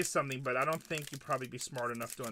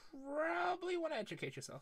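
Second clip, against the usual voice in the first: much higher, sing-song condescending; mocking high voice; 13 semitones higher, 4 dB quieter than usual. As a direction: talk down to him in a prissy voice. Mocking a chat critic.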